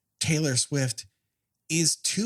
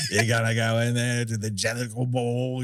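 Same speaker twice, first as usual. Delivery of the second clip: Macavity; faster than usual. Acted. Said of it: "how does Macavity, like".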